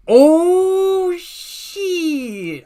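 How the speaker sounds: long, high, loud "oh shit"; shocked exclamation. Real, not acted. Streamer spots a suspicious release date, loudly amazed.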